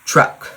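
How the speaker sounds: imitating a child's firmly enunciated "Truck"; firm enunciation. Mom mimics her daughter naming what's on TV, "Truck" firmly enunciated. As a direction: say "Truck" as one firm, carefully enunciated word. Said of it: "she very firmly enunciates her words".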